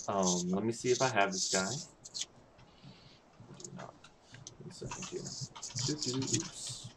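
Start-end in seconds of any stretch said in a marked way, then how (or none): 1.7-6.9 muttering to himself. Real, not acted. GM mutters while searching.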